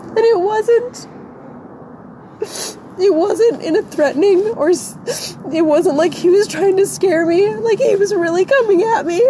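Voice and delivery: real tearful, very high-pitched voice; crying. Real, not acted. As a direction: struggle to get the story out through sobs. Crying as she recounts the attack.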